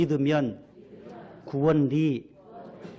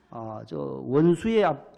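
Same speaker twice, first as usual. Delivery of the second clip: usual speaking voice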